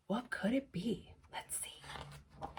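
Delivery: whispered, building suspense. Shown as a demonstration. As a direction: drop to a secretive whisper.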